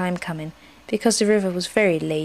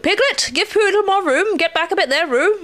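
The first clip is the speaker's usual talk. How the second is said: high, brisk marshalling voice; commanding Rabbit; 11 semitones higher, 3 dB louder than usual. A narrator's reading. Rabbit readies friends for the drop, officious. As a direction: order everyone into place, pitched up.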